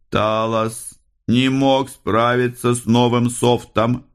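high, slow, entranced voice; speaking into space. A narrator's reading. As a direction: address nobody, drawn out and high. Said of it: "произнёс Икар в пространство, никому конкретно не обращаясь". (в пространство, высоко и медленно)

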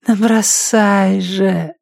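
very slow, high female voice; coaxing woman. A narrator's reading. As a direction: urge it on, drawn out and high. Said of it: "шепнула женщина".

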